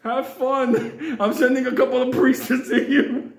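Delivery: high, laughing, flippant goodbye; breezy brush-off. Pretend. Parent ditching the possessed daughter with a laughing brush-off. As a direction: wave off the possessed kid cheerfully. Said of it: "I'll be like".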